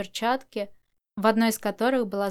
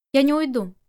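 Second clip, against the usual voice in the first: louder, higher, firm refusal; 4 semitones higher, 6 dB louder than usual. A narrator's reading. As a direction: stand your ground, louder. Quick retort in narrated dialogue. (твёрдый отказ, громче)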